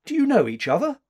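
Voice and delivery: high, loud astonished question; surprised. A narrator's reading. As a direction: ask it in open surprise. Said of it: "she said in surprise".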